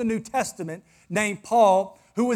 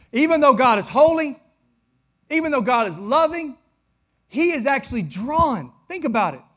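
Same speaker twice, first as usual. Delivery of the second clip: fervent preaching; 6 semitones higher, 8 dB louder than usual. Acted. Pastor to silent congregation, repeating it in a loud preaching build. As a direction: hammer it home so they answer.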